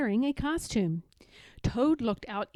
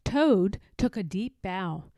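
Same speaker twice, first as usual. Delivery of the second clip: plain reading voice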